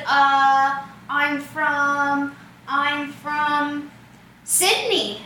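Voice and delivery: drawn-out, hesitant searching for an answer; mock hesitation of a caught faker; imitated stalling. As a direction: stall on each phrase, then land it.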